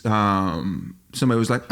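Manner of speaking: ordinary delivery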